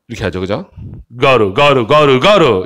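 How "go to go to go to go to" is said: "go to" repeated quickly as "ga-ru"; linked pronunciation. Shown as a demonstration. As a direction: tap the t in "go to" like a light r. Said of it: "이렇게 발음됩니다".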